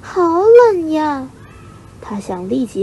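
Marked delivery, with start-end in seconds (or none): 0.0-1.3 high, loud, drawn-out cry of cold; freezing cold. A narrator's reading. Chick woken by cold night wind, freezing cry. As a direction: wail slowly at the chill in a high voice. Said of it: "半夜却被一阵冷风吹醒".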